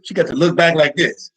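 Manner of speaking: usual speaking voice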